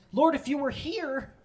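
high, slow, drawn-out reproach; grieving Martha. Acted. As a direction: stretch out Martha's complaint to Jesus. Martha's line repeated, drawn out.

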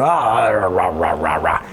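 wordless "rah rah" griping, falling in pitch; imitated angry grumble running downhill; mock grumbling rant. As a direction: grumble in nonsense syllables. Mimics his former negative ranting.

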